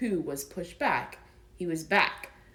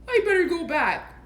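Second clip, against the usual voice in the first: high, louder worried bear voice; fretting Pooh; 10 semitones higher, 7 dB louder than usual. Acted. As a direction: fret like a stuck little bear.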